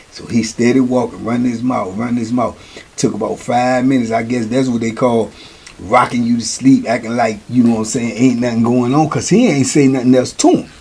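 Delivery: ordinary talk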